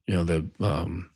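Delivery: usual speaking voice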